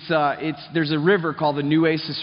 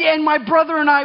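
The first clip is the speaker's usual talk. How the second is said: ordinary delivery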